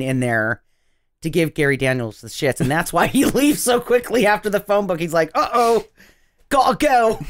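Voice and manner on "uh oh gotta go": higher, hammed-up Cockney voice; botched Cockney accent. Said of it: "he's fucking Cockney, Jackie".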